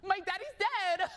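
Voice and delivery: hammed-up wail. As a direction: overplay the grief. Ophelia grieves.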